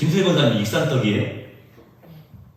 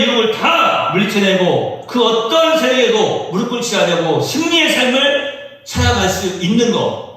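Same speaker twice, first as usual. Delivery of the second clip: preaching at full force; 5 semitones higher than usual. Acted. Pastor urging the church about its purpose, fervent preaching. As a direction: preach it out, building to a cry. Sermon climax, rising fervor.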